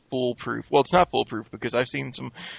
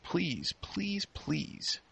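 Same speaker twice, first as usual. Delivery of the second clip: pleading whisper; 3 semitones higher, 14 dB quieter, much slower than usual. Acted.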